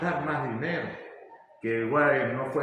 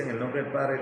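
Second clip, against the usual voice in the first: ordinary talk, nothing marked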